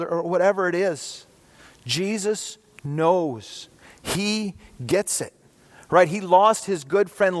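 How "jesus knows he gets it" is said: slow, soft and tender, letting each word land; gentle reassurance. Real, not acted. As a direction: comfort someone who has lost a loved one. Preacher consoling the grieving.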